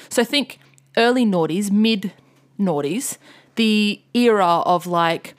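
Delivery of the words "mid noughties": ordinary talk, nothing marked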